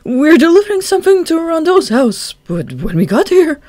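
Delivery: high, loud stammering fake fear; trembling with fear. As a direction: stutter like a scared kid. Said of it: "voice was trembling with fear".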